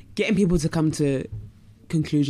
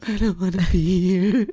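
retold crying; 3 semitones higher, 5 dB louder than usual. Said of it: "I was just crying".

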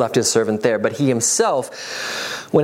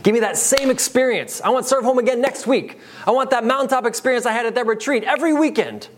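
mock needy believer; 11 semitones higher than usual. Mocking believers craving experiences.

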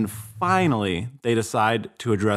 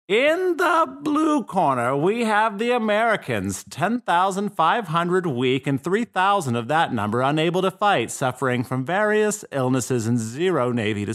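boxing ring announcer; 8 semitones higher than usual. Pretend. Announcing the fighters to the crowd, ring announcer style. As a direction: hype the red corner like a ring announcer. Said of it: "it sounded like you were barking".

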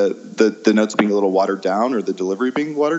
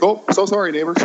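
gushing apology pitched way up; over-apologetic; 10 semitones higher, faster than usual. Retold or imitated. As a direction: gush the sorry much higher, all on the surface. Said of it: "the immediate".

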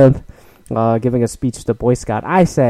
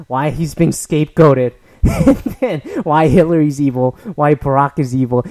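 ordinary talk, nothing marked

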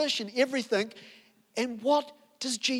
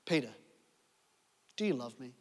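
asked softly and low, tender; gentle question; 8 semitones lower, 6 dB quieter, faster than usual. Acted. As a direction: ask him quietly, full of love. Jesus gently asks Peter.

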